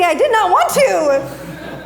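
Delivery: high, drawn-out stubborn protest; hammed-up reluctance; mock petulant refusal. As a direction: dig in slowly, pitched high. Acting out own stubborn reluctance.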